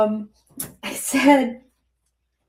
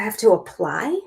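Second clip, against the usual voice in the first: incredulous question; 7 semitones higher than usual. Retold or imitated.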